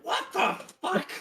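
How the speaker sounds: high squeaky what; a tiny goblin's high disbelief; mock goblin shock. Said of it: "goblin ... is going like".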